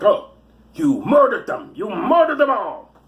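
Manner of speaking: slow, high, accusing TV-detective impression; hammed-up accusation, each word stretched; Quincy. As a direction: imitate an indignant TV investigator, slowly. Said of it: "That was Quincy".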